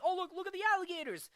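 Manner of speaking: very high, rapid mock alarm; squeaked, hurried imitation of onlookers; excited pointing out. The sons spotting the alligators, high and excited.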